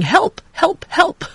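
high frantic cries for help; crying for help. Acted. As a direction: call out for help in a panic. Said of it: "ran along the path crying".

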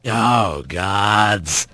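low, pained groan; exhausted, low-voiced exasperation; weary groan. A narrator's reading. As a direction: groan it out, pitched well down. Exhausted Bobcat interrupts with a groan.

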